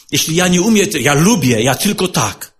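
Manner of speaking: ordinary talk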